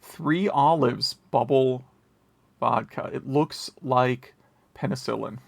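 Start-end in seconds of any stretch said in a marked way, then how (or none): none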